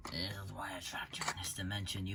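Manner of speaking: eerie voiceless whisper; whispering spirit. Acted. The spirit scolding him, an eerie whisper. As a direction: breathe it like a ghost.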